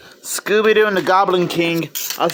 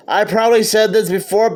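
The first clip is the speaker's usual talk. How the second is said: usual speaking voice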